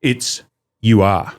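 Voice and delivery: low, slow know-it-all correction; pedantic correction. Retold or imitated. Mimicking Facebook commenters, a slow pedantic correction. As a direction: correct them like a grammar pedant. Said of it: "they started responding".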